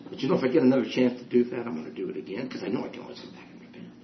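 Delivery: hushed, hurried mock aside, fading almost to a whisper; confidential aside. Preacher voicing a false penitent's hidden plan, hushed aside. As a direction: slip it in under your breath as a false penitent.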